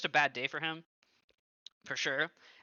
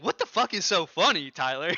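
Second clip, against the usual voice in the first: loud, high mock outrage; retold furious demand; imitating an angry snap; 4 semitones higher, 7 dB louder than usual. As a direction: throw his angry question back at him. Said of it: "but he was pissed".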